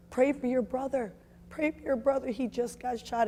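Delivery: high, urgent retold cry to pray; frantic mother calling out; urgent plea. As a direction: urge them to pray with rising alarm. Urging family to pray, brother shot.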